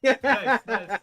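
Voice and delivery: laughing. Real, not acted. Laughs at the accidental spoiler.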